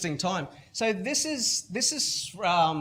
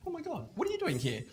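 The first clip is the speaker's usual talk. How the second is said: high, softer gushing surprise; 9 semitones higher, 7 dB quieter, faster than usual. Retold or imitated. Colleague excitedly greeting the consultant, high and soft. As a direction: squeal it like a delighted fan.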